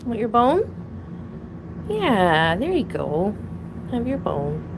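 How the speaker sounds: high, sweet coaxing voice to the pet; pet talk. Real, not acted. Owner offering her dog a bone, high sweet pet talk. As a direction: coax the dog to take its bone.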